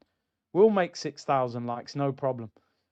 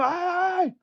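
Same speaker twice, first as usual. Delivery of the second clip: mock scuffle shouting; 13 semitones higher than usual. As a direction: imitate a touchline row kicking off. Acting out touchline scuffle starting.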